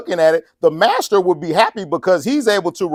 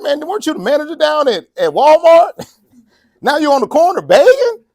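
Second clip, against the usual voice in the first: mocking acquaintance; 9 semitones higher, 5 dB louder than usual. Acquaintance mocks former manager.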